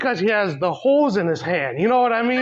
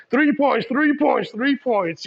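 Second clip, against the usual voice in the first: mock basketball call; 5 semitones higher, slower than usual. Jesus celebrating a score, mock hyped chant. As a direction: cheer each score like a hyped player.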